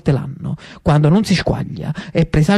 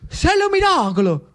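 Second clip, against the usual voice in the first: mock general cry; 10 semitones higher than usual. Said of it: "il generale francese gridò".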